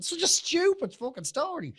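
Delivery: high, loud laughing outburst; shrieking with laughter. Real, not acted. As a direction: burst out laughing at the silly story. Laughing at the donkey joke.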